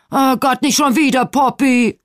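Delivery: put-on loud, high outcry at a child; exasperated yell. A narrator's reading. Mother hearing another crash behind her, exasperated yell.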